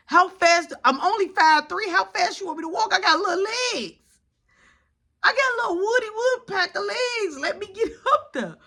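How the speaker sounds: high, tearful mock whine; whiny protest. Short son hurrying behind mom, high whiny protest. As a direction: complain like a kid struggling to keep up.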